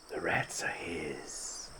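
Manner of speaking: a slow, hushed whisper; slow whispered aside. Real, not acted. Aside about the rats.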